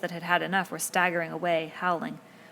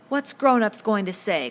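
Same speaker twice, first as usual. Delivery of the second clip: slow, louder fretful cry; anxious cry; 4 semitones higher, 8 dB louder than usual. A narrator's reading. Piggy cries out in dismay at the chaos. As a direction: wail it out in worry. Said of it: "cried Piggy again".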